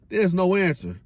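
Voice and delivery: normal talking voice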